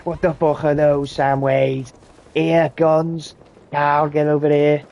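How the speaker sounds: hammed-up sergeant calling the others over; sergeant yelling. Sergeant summoning staff to see the feet, mock shout. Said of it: "So he goes".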